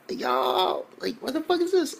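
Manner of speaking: very high, squealed disbelief; shocked reaction. Retold or imitated. Reacting to ridiculous fan service, shocked. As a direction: exclaim it high, utterly baffled.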